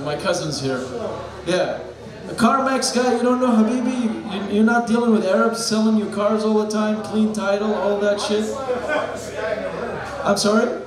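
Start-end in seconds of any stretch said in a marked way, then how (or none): none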